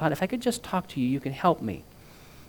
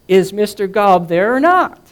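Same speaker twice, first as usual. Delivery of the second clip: higher, loud, slowed operator demand; exasperated operator, higher and loud; impatient operator; 8 semitones higher, 12 dB louder than usual. A narrator's reading. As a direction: press him like an exasperated operator. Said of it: "the operator asked".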